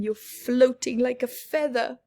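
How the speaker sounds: ordinary delivery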